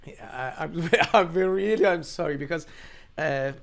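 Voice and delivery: high, loud and choked up, laughing through tears; fighting back tears with a nervous laugh; emotional, laughing through tears. Real, not acted. Father telling the interviewer about fleeing, choked up with tears. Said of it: "I know I'm very emotional".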